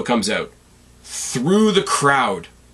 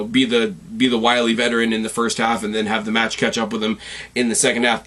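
ordinary talk, nothing marked

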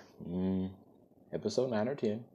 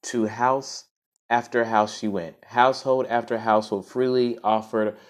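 clear, fuller storytelling voice; story narration; 3 semitones higher, 6 dB louder than usual. Acted. Host reads the story to listeners, in a storybook narration. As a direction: read it out like a storybook.

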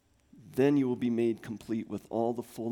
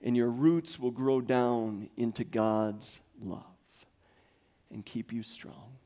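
normal talking voice

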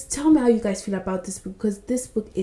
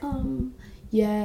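soft, hesitant mock um; flustered stalling; 4 semitones higher, 6 dB quieter than usual. Heroine not knowing what to say, flustered. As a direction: imitate being lost for words. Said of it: "she doesn't know what she's saying".

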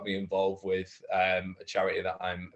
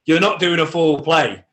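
loud, high, impassioned reassurance; raised, insistent pep talk; emphatic pep talk; 6 semitones higher, 10 dB louder than usual. Real, not acted. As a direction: drive it home like a rousing coach. Hammering home the same reassurance.

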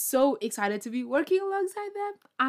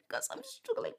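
a much higher, thin, whiny voice; whiny plea; 10 dB quieter than usual. Pretend. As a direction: play it like a helpless little kid begging. Mock pleading prayer to God.

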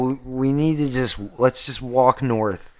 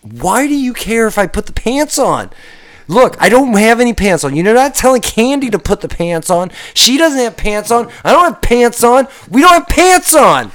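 angry; 9 semitones higher, 4 dB louder than usual. Acted. Angry rising argument with mayor.

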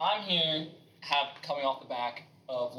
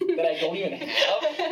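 laughing through the words; 6 semitones higher, 6 dB louder, slower than usual. Real, not acted. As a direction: chuckle while saying it. Joking about quitting nonexistent job.

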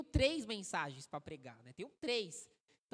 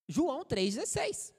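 announcing the text; 4 semitones higher, 8 dB louder than usual. Shown as a demonstration. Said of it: "Nós vamos ler aqui".

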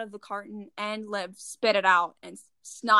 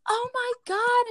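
high, slow, overdone "oh my God"; overdramatic gasp; 11 semitones higher, slower than usual. Retold or imitated. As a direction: stretch it out like a drama queen. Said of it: "was just so overdramatic".

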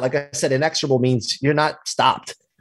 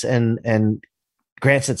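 normal talking voice

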